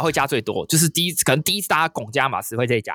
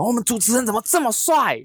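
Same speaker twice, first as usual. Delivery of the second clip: put-on high, teasing drunk boss voice; tipsy boss; 9 semitones higher than usual. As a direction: ham up the tipsy general manager's flattery. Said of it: "总经理就上台说什么".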